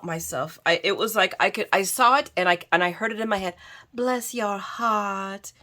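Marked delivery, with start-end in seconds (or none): 3.9-5.5 slow, softer Southern drawl; Southern accent. Shown as a demonstration. Crafter voicing the phrase she heard in her head, Southern accent. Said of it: "that's the best Southern accent I can do".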